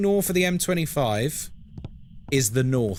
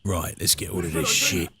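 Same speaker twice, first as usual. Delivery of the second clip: low mutter; 10 semitones lower than usual. Shown as a demonstration. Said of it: "repeats it".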